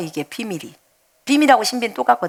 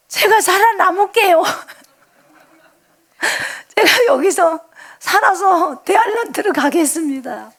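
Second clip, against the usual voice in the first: higher, choked-up vow; retold tearful plea to the Lord; half-sobbing, higher vow; crying promise; 7 semitones higher than usual. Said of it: "막 이랬어요".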